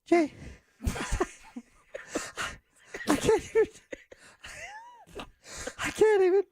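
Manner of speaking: high, breathy and wounded; Marilyn Monroe. Pretend. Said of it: "Miss Marilyn Monroe".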